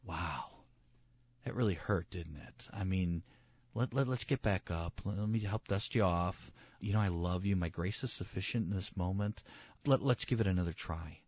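soft, low, tender reassurance; gently help someone back up, hushed and unhurried; gentle Jesus voice. Acted. Jesus comforting a believer who fell, gentle. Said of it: "Jesus' voice sounds like this".

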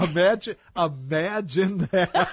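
high, drawn-out delight with laughter; playful high exclamation breaking into a laugh; happy voice. Pretend. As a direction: ham up the happy radio host. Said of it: "in your happy voice".

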